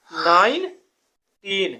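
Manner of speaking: Nineteen stretched out very slowly, every syllable held; drawn-out answer. Shown as a demonstration. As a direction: let nineteen hang slowly like a quiz answer. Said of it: "कहाँ तक जाता है?".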